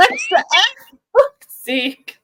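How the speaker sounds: squeaky incredulous question; high disbelief. Real, not acted. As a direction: rise up in disbelief. Exasperated laughing disbelief.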